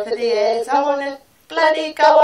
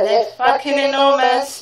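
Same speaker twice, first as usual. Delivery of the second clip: ordinary talk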